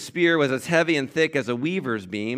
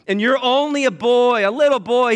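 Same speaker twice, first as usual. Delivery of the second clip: put-on higher and louder, scoffing at a boy; mock him as just a little boy; scoffing king; 10 semitones higher, 7 dB louder than usual. King Saul scoffing at young David, higher and louder.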